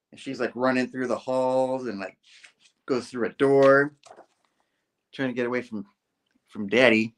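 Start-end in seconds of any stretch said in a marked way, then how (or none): none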